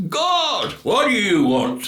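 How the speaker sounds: loud, commanding voice from above; booming voice of God. A narrator's reading. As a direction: answer like a mighty god. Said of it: "said this voice".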